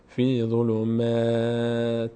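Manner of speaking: slow "فی ظلمات", light fronted "maa"; thin "m". Shown as a demonstration. Teacher repeats the correct stopped form, thin long "maa". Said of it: "میم هیچ وقت پرخونده نمیشه".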